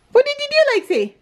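high, coaxing question; potty training praise. Real, not acted. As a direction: coax her to tell proudly. Prompting toddler to tell.

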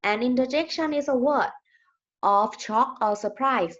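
very slow, word-by-word reading for students; slow dictation reading. Shown as a demonstration. Teacher reading a definition to her class, slow and deliberate. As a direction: spell it out slowly so the class can follow.

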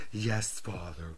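low, slow, soft camp drawl; flamboyant Christopher impression. Son doing the TV designer for his dad, slow and low. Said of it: "And I'd be".

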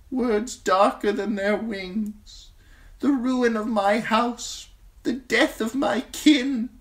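put-on high, frail old man speaking slowly and gravely; an ancient maester teaching a hard lesson. Old maester lectures Jon on the Night's Watch vows, high and grave. Aemon recounts his tested vows.

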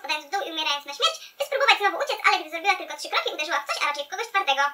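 ordinary talk, nothing marked

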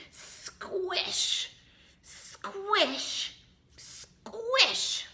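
high, drawn-out hissy squish; squishing sound effect. Pretend. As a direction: stretch and squeeze each squish. Acts out squashing the bee.

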